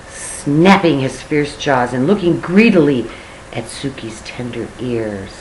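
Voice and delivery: ordinary delivery, nothing marked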